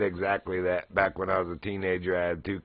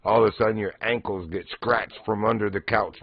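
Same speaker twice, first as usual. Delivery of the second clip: usual speaking voice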